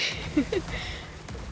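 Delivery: breathy giggle rising at the end; cringing at her own accent; sheepish airy laugh; embarrassed laugh. Real, not acted. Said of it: "Maybe pretend I never did that".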